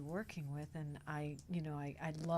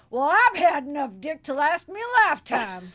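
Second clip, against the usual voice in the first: much higher, much louder blurted punchline; blurting out; 9 semitones higher, 18 dB louder than usual. Retold or imitated. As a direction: blurt it out into the silence. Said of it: "Sybil perks up and goes".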